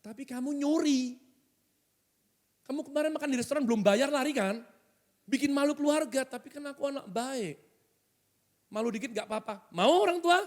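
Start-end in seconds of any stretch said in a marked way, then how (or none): 0.0-6.3 high-pitched parental scolding; acted parent shaming the child; accusing parent. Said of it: "Papa Mama bilang". 6.3-9.7 soft, high little protest; small-voiced excuse; meek child. Child's meek reply to parents.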